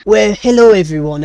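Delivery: put-on French accent. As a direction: redo the French greeting, a bit calmer. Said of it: "he still speaks in a French accent".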